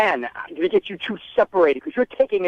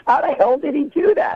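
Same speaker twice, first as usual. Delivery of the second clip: stunned disbelief; 10 semitones higher than usual. Real, not acted. Learns prank was brother's idea.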